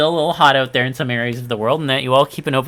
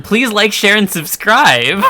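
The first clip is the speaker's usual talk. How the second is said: high, loud, bouncy YouTuber sign-off; hyped YouTuber plug; 8 semitones higher, 9 dB louder, slower than usual. Pretend. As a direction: sell it like a channel outro.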